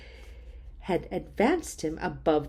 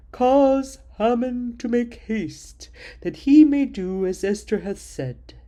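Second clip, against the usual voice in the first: a king granting favor in a grand proclaiming tone; 4 semitones higher, 7 dB louder than usual. Acted. King offers Queen Esther half his kingdom, booming and grand.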